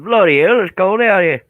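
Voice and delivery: high, loud, badly faked British-Australian accent; botched UK accent. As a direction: attempt a British accent and mangle it loudly. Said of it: "I totally screwed up that accent".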